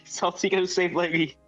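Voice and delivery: ordinary delivery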